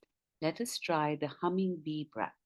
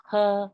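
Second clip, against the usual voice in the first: humming bee sound; 5 semitones higher, 11 dB louder than usual. Shown as a demonstration. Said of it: "like a humming bee".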